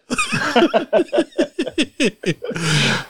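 long, high-pitched laugh. Real, not acted. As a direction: laugh helplessly at your own blunder. Laughs at own blunder.

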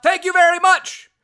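high, very loud imitated shout; big high-pitched holler; Jordan's yell. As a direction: yell it out as a catchphrase. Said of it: "when Jordan yells out".

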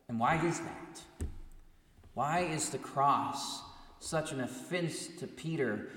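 ordinary delivery

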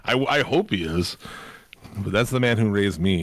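usual speaking voice

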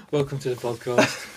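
flat, bored welcome, no enthusiasm; deadpan. Pretend. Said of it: "dial up the enthusiasm".

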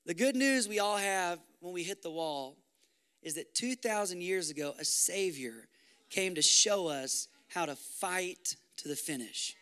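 normal talking voice

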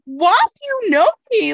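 high, loud incredulous question; a bewildered kid caught off guard; baffled kid. Retold or imitated. Baffled kid meets teacher.

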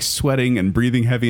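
ordinary talk, nothing marked

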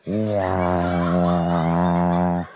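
deadpan deep wow like a crowd; mock awed wow. Comic drops a deep booming wow.

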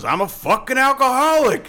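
high, loud comic outburst; mock defiant yell. Old man answering his berating woman, loud hammed-up yell. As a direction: yell the confession back, hammed-up.

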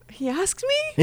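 high, squeaky disbelief; incredulous question. Acted. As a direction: pitch shoots up in mock surprise. Surprised reply in game.

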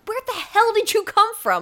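high-pitched retold startled reaction; startled disbelief. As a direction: exclaim it as if someone popped up from nowhere. Retells startled reaction to stranger.

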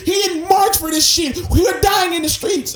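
loud, high impassioned rant; shouted speech at full pitch; screaming protest. Retold or imitated. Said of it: "she's screaming".

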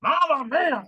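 high, loud hammed-up Mamma mia; loud, high mock announcer cry; mock announcer catchphrase. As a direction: ham it up like a cheesy ring announcer. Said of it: "Chat G P T of an announcer".

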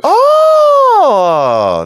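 long, high, loud "oh" of discovery; delighted realization. Real, not acted. Co-host hearing cartoon voice trivia, loudly impressed. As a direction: draw it out high, genuinely amazed. Real reaction to actor trivia.

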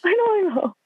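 a quick, high-pitched "I know, I know"; high exasperated reassurance; high flustered voice. Retold or imitated. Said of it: "I was like".

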